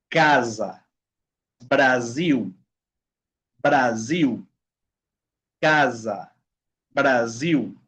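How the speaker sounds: "Casa", "Brasil" one at a time with S as buzzed Z; spaced "Casa", "Brasil", voiced Z and final L as a W; buzzing S. Shown as a demonstration. Teacher modelling S between vowels for students, bee-buzz S. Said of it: "un sonido como de abeja".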